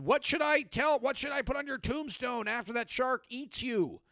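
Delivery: high-pitched mock-desperate pleading; hammed-up distraught spouse; panicked spouse calling out. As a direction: cry it out to someone in danger. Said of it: "just being like".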